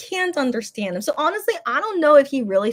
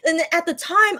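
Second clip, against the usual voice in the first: ordinary talk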